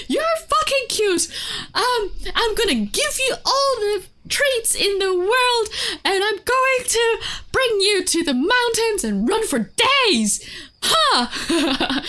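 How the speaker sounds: high, loud mock rant; scolding. Shown as a demonstration. Telling a dog it gets treats, high loud scolding. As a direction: hurl sweet promises like threats. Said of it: "I can just scold them".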